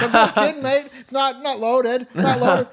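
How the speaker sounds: lightly voiced thug laughing off the gun; a hint of the ex-military guy brushing it off; joking villain. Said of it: "But he's like".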